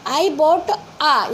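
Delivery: drawn-out, each word held; slow dictation. Shown as a demonstration. Teacher pointing at the article a slowly. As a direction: read it very slowly. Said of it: "यहाँ पर हमने ए भरा".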